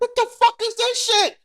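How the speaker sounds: high-pitched, indignant outburst; acted outrage. Husband going off on his wife over dinner, acted outrage. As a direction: blow up in disbelief, pitch shooting up.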